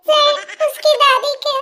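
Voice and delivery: can barely keep a straight face; laughing lie. Acted. Assistant lies to boss, stifling laughter. Inventing grandmother excuse, giggling.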